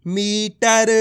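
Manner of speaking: मीटर stretched, with a long ee in मी; drawn-out reading. Shown as a demonstration. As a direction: sound मीटर out syllable by syllable. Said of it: "खींच खींच के पढ़ना है".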